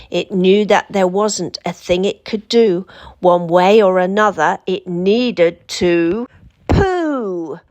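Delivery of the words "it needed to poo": slow and drawn out, timbre changed; a slow, stretched-out reveal of the punchline. A narrator's reading. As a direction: stretch the last words for the joke.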